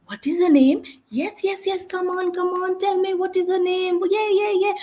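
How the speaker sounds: high, rushed, pestering questions; over-eager adult demo; pushy questioning. Shown as a demonstration. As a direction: keep pressing the child for an answer. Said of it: "continue to give lot of verbal instruction".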